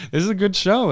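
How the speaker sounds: ordinary talk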